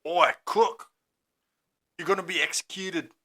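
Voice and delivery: high mock Scottish jeer at a condemned man; Scottish accent. Mock Scottish jeer taunting the condemned killer in his cell. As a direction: sneer it out in a jokey Scottish accent. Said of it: "That was Scottish for some reason".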